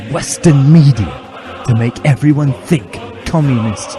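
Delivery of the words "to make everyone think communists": ordinary talk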